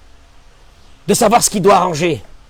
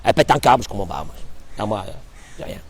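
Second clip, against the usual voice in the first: smug husband; 7 semitones lower, 4 dB quieter, faster than usual. Acted. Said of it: "Il dit, regarde".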